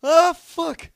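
high-pitched, full-volume shocked yell; terrified scream. Real, not acted. Player waiting for it to come straight at him, terrified scream. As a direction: shriek the curse as it hits you. Jump scare hits.

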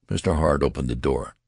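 plain reading voice

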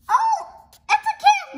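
high, loud thrilled cry; delighted squeal. Real, not acted. Kid thrilled to find the candy parade.

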